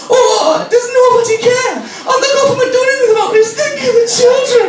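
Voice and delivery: very high, loud Welsh wailing; Welsh accent. Retold or imitated. Welsh nurse carrying on about neglected youth, wailing Welsh accent. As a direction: cry out in mock Welsh outrage. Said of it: "she's also from Wales".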